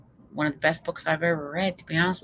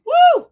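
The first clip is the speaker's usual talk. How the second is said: excited whoop; 15 dB louder than usual. Real, not acted. Cheering at start of talk.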